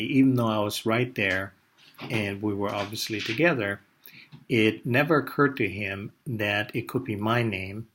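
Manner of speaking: ordinary talk, nothing marked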